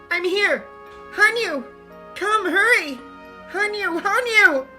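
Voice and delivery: loud, desperate yells; half-mad shouting. Acted. Rika shouting Hanyu's name as if gone mad, loud and desperate. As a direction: scream her name over and over. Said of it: "I kept shouting her name as if I'd gone mad".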